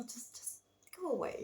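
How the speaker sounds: high, soft little voice shooing the fish away; shooing voice of her aura. Pretend. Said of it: "kinda telling those fishes".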